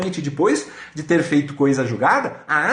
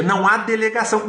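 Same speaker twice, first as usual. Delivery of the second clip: usual speaking voice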